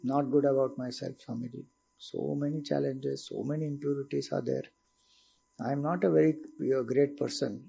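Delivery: softly voiced, downcast confession; dejected student. Student confessing to Swamiji after introspection, dejected and quiet. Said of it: "how do you feel?".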